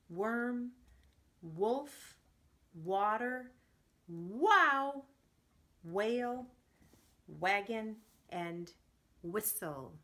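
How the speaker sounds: loud, clear, one word at a time for the class; loud repeat-after-me drill. Shown as a demonstration. Teacher calling out W words for the class to repeat, loudly. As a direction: call each word out so the kids can echo it. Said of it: "repeat after me".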